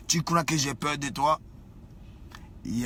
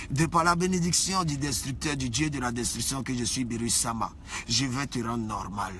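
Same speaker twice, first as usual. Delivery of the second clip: usual speaking voice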